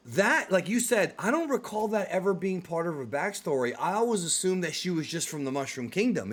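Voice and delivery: normal talking voice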